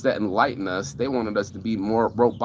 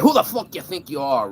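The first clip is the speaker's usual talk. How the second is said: angry tough guy; 7 semitones higher, 3 dB louder than usual. Retold or imitated. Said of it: "The dude's like".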